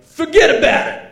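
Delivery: put-on high, loud shout in a botched Liverpool accent; hammed-up failed Liverpool accent, yelled high; wrong accent. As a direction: belt it out in a comically wrong accent. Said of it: "Wrong accent, guys".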